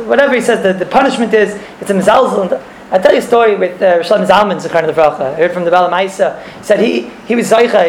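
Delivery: ordinary delivery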